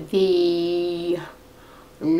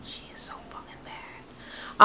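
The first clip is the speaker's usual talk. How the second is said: whispered aside; 8 dB quieter than usual. Real, not acted. Whispered aside about the pet.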